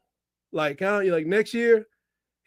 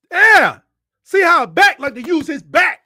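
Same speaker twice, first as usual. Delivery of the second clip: higher, louder excited fan shouting; football yelling; 9 semitones higher, 8 dB louder than usual. Real, not acted. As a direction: cheer the runner on at the top of your lungs. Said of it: "It's my football voice".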